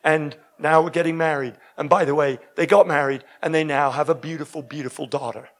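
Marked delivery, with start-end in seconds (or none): none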